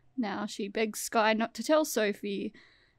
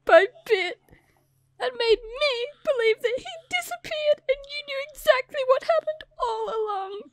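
much higher, sobbing accusation; hammed-up tearful daughter, voice breaking; melodramatic Sophie; 13 semitones higher, 7 dB louder than usual. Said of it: "I'll be Sophie for the end".

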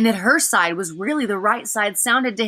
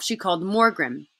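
plain reading voice

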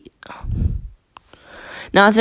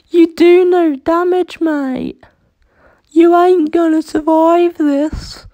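high, loud cocky villain taunt; 14 semitones higher, 4 dB louder than usual. Pretend. Taunting the enemy in battle.

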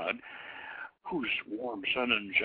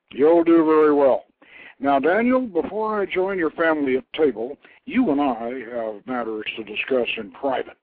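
louder, booming god voice; lightly voiced mighty craftsman god, pleased; god Kothor voiced; 8 dB louder than usual. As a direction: speak as a proud inventor god.

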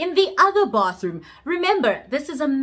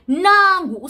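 sharp, loud and high burst; excited exclaim; 7 semitones higher, 5 dB louder than usual. Real, not acted. Narrator bursts out to her audience, loud and high. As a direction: jump in hard to announce the twist. Sudden new turn in story.

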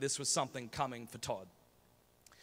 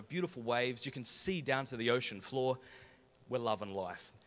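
ordinary talk, nothing marked

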